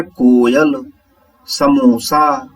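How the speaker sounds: Coil and samosa said very slowly, each syllable drawn out; slow modelling. Shown as a demonstration. Teacher models example words slowly for pupils. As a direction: spell the words out slowly for the class.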